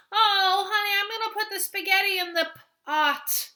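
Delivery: high, loud mock chatty voice; mock talking voice. Shown as a demonstration. Teacher mocking how people talk, hammed-up high voice. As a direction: ham up a sing-song everyday chat. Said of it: "That's not how I talk".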